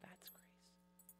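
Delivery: whispered, hushed. Real, not acted. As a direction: breathe it out as a secret. Hushed punchline after a pause.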